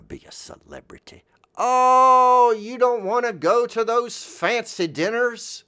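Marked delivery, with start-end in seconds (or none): 1.6-5.6 high-pitched drawn-out "oh"; exaggerated, incredulous high voice; mock astonishment. Someone questioning a refusal of fancy dinners, mock astonished.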